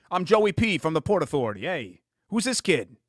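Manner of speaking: a lightly voiced New York guy from the Port Authority; New York accent. Shown as a demonstration. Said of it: "How would Joey say his name".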